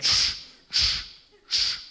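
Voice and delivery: whispered shh shh of chalk on a board; mock chalk scratching; chalk squeak noise. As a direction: hiss out the sound of drawing on a blackboard. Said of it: "and he went".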